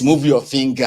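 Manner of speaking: normal talking voice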